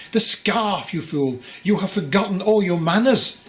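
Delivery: high, loud scolding scream; mother screaming at her foolish husband. A narrator's reading. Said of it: "the mother screamed at the father".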